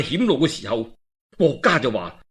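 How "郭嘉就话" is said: ordinary delivery, nothing marked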